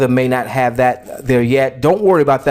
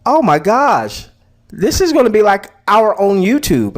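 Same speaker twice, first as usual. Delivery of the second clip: excited realization; 7 semitones higher than usual. Retold or imitated. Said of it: "I'm saying".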